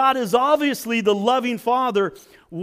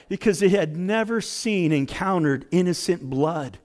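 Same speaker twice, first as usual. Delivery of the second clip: ordinary delivery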